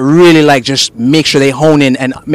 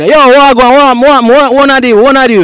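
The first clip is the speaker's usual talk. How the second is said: high, loud Jamaican patois burst; loud boisterous patois; 8 semitones higher, 4 dB louder than usual. Shown as a demonstration. Host showing his patois personality, loud and boisterous. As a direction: go big and extra in Jamaican patois. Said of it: "more loud and boisterous and extra".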